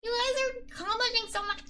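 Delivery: high, bright gushing praise; excited praise. Real, not acted. Streamer praising her chat, excited. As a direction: beam at the chat. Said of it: "I get really excited".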